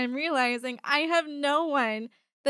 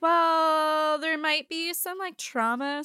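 high, drawn-out hesitant "well"; imitated hedging medics; 7 semitones higher, 5 dB louder, slower than usual. Medics break possible head trauma to patient, hedging. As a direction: hedge in a raised mock-official voice.

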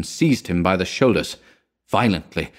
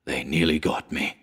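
soft, low, breathless gasp; the words escape on a shaken breath; 4 semitones lower, 6 dB quieter than usual. A narrator's reading. Shaken survivor gasps to his friend, soft and low. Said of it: "he gasped".

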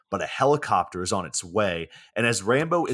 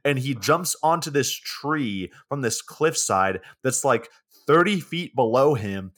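normal talking voice

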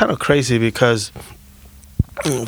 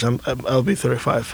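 ordinary talk, nothing marked

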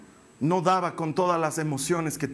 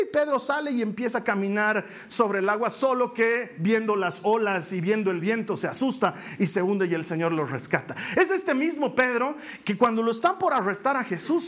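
ordinary talk, nothing marked

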